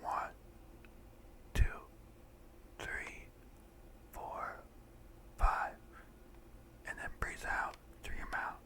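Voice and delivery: slow, mostly whispered breathing count; guided breathing meditation. Acted. Guide a friend through breathing in and out, hushed meditation voice.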